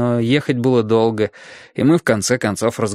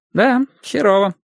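casual, higher-pitched agreement; carefree; 10 semitones higher than usual. A narrator's reading. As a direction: confirm it lightly, without concern. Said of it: "беззаботно подтвердил он". (беззаботное согласие)

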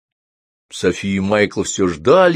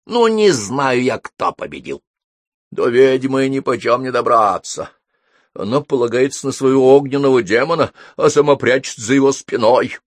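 wizard explaining himself; 6 semitones higher, 5 dB louder than usual. A narrator's reading. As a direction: explain it loudly, with dramatic swoops. Wizard recounts duel to staring friends. (громкое объяснение волшебника)

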